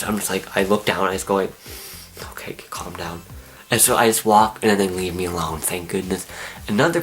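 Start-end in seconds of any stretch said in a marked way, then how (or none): 2.3-3.2 barely audible, breathy self-reassurance; hushed self-calming. Retold or imitated. Frightened visitor calming herself among clowns, hushed whisper. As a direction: whisper to yourself.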